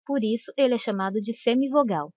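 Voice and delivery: usual speaking voice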